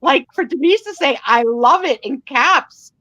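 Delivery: loud and high, thrilled; excited. Real, not acted. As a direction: gush about it, big energy. Excited about Denise's bid.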